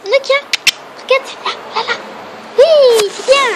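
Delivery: very high, loud cooing at a dog. Real, not acted. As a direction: coax the puppy in squeaky praise. Coaxing the dog to look.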